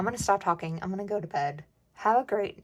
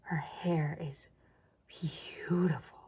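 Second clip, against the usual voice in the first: slow, hushed admiring whisper; whispered child awe; 3 semitones lower, much slower than usual. Retold or imitated. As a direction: whisper it like an awed little girl. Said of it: "she was like".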